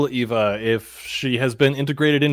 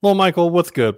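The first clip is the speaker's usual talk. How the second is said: high, bright hello to a pet; excited greeting; 6 semitones higher, 4 dB louder than usual. Acted. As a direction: call out to the bird. Greeting the bird.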